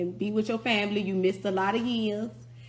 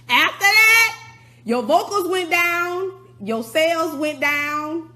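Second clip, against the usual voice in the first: starts very high and loud, then settles; slow, high outraged yell; angry shout, fading; 7 semitones higher, 8 dB louder, slower than usual. Real, not acted. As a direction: yell it then ease down slowly. Escalating rant about Kim.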